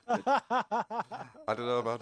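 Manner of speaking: words broken by laughter; laughing. Real, not acted. Laughing at the joke.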